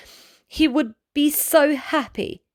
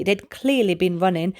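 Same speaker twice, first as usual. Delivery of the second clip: ordinary delivery, nothing marked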